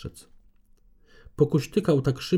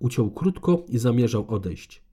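ordinary delivery, nothing marked

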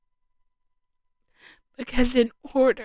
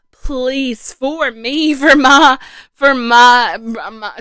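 pleading; 3 semitones higher, 12 dB louder than usual. Real, not acted. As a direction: cry out to God, pleading. Fervent prayer climax, cry out.

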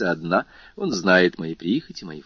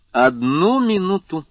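louder, higher, drawn-out interjection; interrupting; 10 semitones higher, 5 dB louder, slower than usual. A narrator's reading. Albert cuts in before the count can answer, interrupting. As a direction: break in loudly to halt the talk. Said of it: "прервал Альбер".

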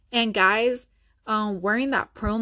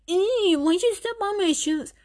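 mock whiny complaint; 8 semitones higher than usual.

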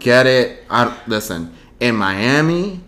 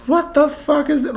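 shocked. Retold or imitated. Reacting to her bad painting.

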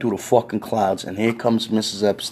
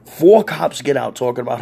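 usual speaking voice

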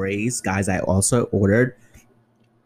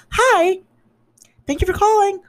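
much higher, bright sing-song phone-greeting voice; customer service voice; 7 dB louder than usual. Retold or imitated. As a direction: chirp it like a fake-cheerful call center agent. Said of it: "to have to talk like this".

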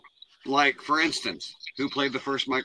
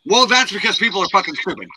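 loud exasperated rant; 9 semitones higher, 10 dB louder than usual. Real, not acted. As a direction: blurt it out, fed up. Annoyed rant about the map poll.

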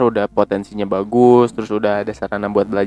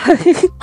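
high laugh; 5 dB louder than usual. Real, not acted. Cracking up at joke.

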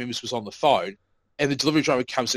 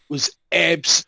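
ordinary talk, nothing marked

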